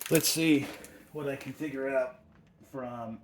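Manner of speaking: talking while bent over. Real, not acted. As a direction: speak doubled over at the waist. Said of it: "if I sound weird, I'm bent over".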